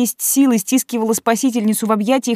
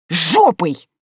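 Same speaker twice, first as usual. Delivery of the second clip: snapping angrily; 3 dB louder than usual. Acted. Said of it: "Вспылив".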